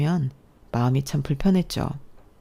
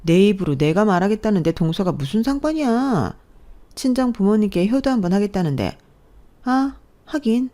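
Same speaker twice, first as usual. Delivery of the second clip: nasty taunt; 6 semitones higher than usual. Acted. Sister-in-law taunting mother-in-law as an orphan. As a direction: drag out the mock oops.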